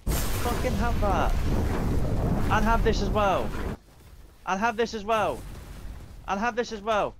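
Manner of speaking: loud, forceful battle taunts; loud angry attack cry; aggressive shouting. Real, not acted. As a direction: hurl each line like a blow. Attacking opponents in game.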